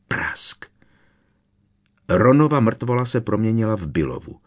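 usual speaking voice

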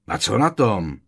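loud, curt challenge; defiant comeback; snapped retort. A narrator's reading. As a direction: snap back without caring. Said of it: "odsekl Kreb".

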